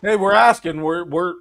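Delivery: ordinary talk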